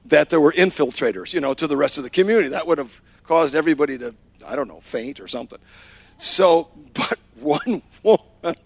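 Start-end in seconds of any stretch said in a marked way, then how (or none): none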